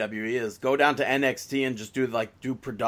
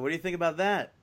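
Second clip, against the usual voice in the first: normal talking voice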